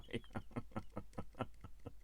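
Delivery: high, soft giggling fit; helpless breathy giggle; breathy laughter. Real, not acted. Crafter amused at own cutting, breathy laughter.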